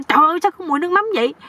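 high, rapid re-enacted exclamation; crying out. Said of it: "con mới la lên". (kêu lên, cao và nhanh)

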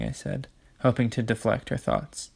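plain reading voice

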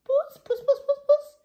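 very high, loud sing-song cat call; old man calling for a cat. Acted. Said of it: "he called".

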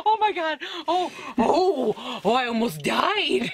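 loud laughing. Real, not acted. Daughter laughing loudly after mom nearly falls off the chair. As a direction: laugh it out after the near fall.

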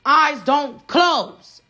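Eyes don't close, spaced and hammered; each word punched. Real, not acted. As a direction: drive home each word. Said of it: "I keep my guard up".

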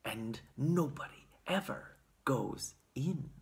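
hushed, mysterious secret; secret whisper. A narrator's reading. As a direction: confide it in a near whisper. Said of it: "secret whisper".

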